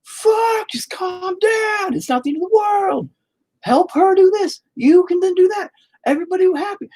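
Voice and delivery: very high-pitched frantic shout; yelling at the kids. Retold or imitated. Parent yelling at fighting siblings, shrill exasperated shout. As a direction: shriek at them to calm down, then plead. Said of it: "I'm like".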